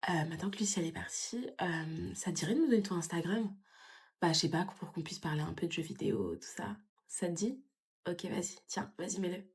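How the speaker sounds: flirting quietly behind a friend's back; whisper. Acted. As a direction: murmur it so Lucie won't hear. Flirts secretly while friend is away.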